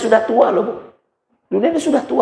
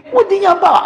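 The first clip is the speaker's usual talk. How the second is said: sharply higher mocking question; a shrill-edged mock Padang wife's taunt; mocking high squeak; 13 semitones higher than usual. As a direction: tease the scared husband. Wife mocks timid husband.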